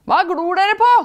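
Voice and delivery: loud, high pestering spirit; yelling. A narrator's reading. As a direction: yell it at the starers. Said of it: "skriker han".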